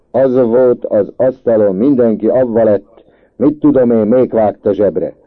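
loud, high angry complaint; heated shouted rant; furious. A narrator's reading. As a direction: rage at the thieves, full voice. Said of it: "már átkozott dühös volt".

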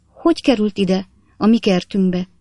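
ordinary delivery

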